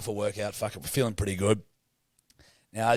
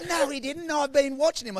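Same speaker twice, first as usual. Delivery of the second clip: imitating her, much higher and hammed-up; high nagging voice scolding at the ice bath; mocking a bossy woman telling them to shower; mock shrill woman; 14 semitones higher than usual. Woman quoted.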